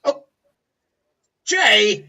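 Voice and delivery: startled, sharp jump in volume and pitch; startled cry. Acted. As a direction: cry out the name, caught off guard. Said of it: "startled, and goes".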